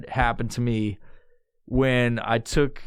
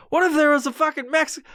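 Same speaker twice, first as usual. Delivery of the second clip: fast high panic; 11 semitones higher, faster than usual. Retold or imitated. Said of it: "I was like".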